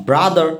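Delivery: ব্রাদার ending on an open vowel; brother, non-rhotic ending; final R dropped. Shown as a demonstration. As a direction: say ব্রাদার with the last R silent. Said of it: "এখানে রায়ের উচ্চারণ হবে না".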